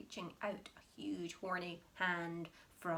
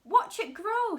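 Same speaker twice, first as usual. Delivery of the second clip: much higher and louder; shout; 13 semitones higher, 13 dB louder than usual. Acted. Mister Cranky shouting at the hen. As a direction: shout it out with glee. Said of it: "shouted Mister Cranky".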